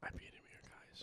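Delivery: whispered aside to viewers; giggly prank whisper. Real, not acted. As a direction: hush it like sharing a secret. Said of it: "Why are you whispering into the mic?".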